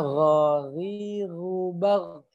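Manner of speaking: ghariru bag drawn out with snore-like gh; ghain berdengkur. Shown as a demonstration. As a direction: rasp every gh in ghariru bag, slowly. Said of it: "Intinya sih lebih kepada dengkur ya". (ghariru bag pelan, gh berdengkur)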